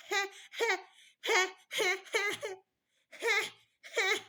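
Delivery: put-on high, short breathy he cries like a fussing baby; baby fuss cry he. Shown as a demonstration. Mother showing other mothers a fussing baby's cry, high mimicking he sounds. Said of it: "This one is".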